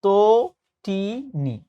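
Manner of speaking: তটিনী very slowly as "to ... ti ... ni"; syllable-by-syllable pronunciation. Shown as a demonstration. Teacher spelling out the easy answer, very slow syllabled তটিনী. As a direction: separate each syllable of তটিনী, "to-ti-ni". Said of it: "শুদ্ধ উচ্চারণ হচ্ছে এইটা".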